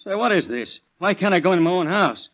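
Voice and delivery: high, loud, indignant demand; angry alarm. Acted. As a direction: push back hard at the detectives. Father barred from his home.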